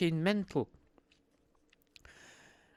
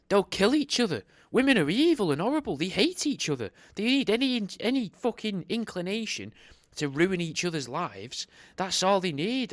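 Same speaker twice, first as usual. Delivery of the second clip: louder, higher ranting; high loud mock rant about women; building rant; 6 semitones higher, 4 dB louder than usual. Real, not acted. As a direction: whip it up into a comic tirade. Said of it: "mock rant".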